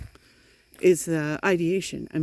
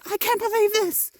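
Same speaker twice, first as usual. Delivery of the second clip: mock shocked squeal, much higher; shocked sister; 12 semitones higher than usual. Sister horrified by the uncle's text, high mock outrage. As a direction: play the sister gasping in horror, high up. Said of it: "She's like".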